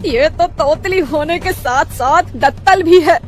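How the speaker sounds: shrieking mother-in-law. Acted. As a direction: wail at the new bride. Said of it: "चिल्लाने लगती है". (बहू पर चीखती सास, धीमे और ऊँचे सुर में)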